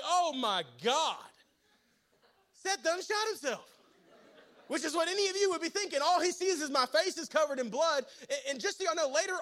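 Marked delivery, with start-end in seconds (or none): 0.0-3.7 shocked stepdad. Retold or imitated. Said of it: "and he's like".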